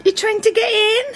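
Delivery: high-pitched singsong question. Real, not acted. Little one climbing into bed, high cooing.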